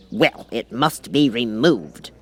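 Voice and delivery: slow, firm king voice; a king settling the matter; very decidedly. Acted. The King decides about the Cat, slow and firm. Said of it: "said the King very decidedly".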